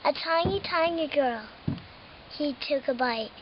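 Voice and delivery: plain reading voice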